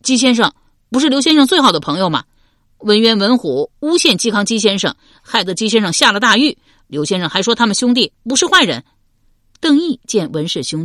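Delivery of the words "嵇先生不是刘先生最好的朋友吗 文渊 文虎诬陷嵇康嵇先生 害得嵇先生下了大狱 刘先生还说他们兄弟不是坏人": loud, high, hot-headed outburst; rapid, raised-voice indignation; outraged accusation. A narrator's reading. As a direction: burst in and protest at full voice. Innkeeper's son rushes out accusing.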